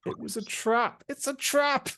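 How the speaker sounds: squeaky high, hammed-up cry of alarm; mock dramatic. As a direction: yelp it way up high like a cartoon alarm. Jokey repeat of the line.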